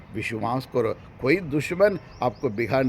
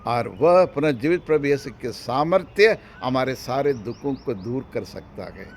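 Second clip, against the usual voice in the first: usual speaking voice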